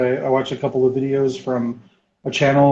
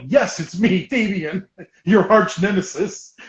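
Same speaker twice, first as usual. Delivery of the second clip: higher, playful proclamation with a laugh; mock-dramatic reveal; 6 semitones higher, slower than usual. Gamer revealing himself to his rival buyer, mock dramatic. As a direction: announce yourself like a comic arch rival.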